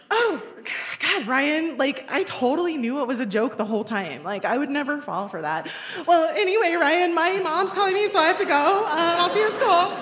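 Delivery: high, brightly chirpy and dismissive; a teenage girl hiding heartbreak from a boy; fake breezy nonchalance. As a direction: cover the hurt with a breezy brush-off. Faking nonchalance after being crushed.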